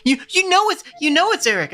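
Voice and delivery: put-on high nasal kid with a stutter; stuttering whiny kid. Shown as a demonstration. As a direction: stammer it like a whiny little boy. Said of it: "I gave him a bit of a stutter".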